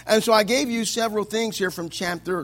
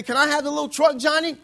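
put-on coaxing a child; 7 semitones higher, faster than usual. Adult asks child in story.

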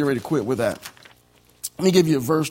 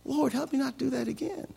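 soft, high-pitched plea; hushed acted repentance; pleading prayer; 9 semitones higher, 8 dB quieter than usual. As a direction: plead quietly with God, pitch raised. Acts out his repentant prayer.

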